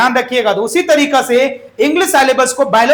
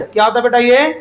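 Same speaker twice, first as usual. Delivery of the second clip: ordinary talk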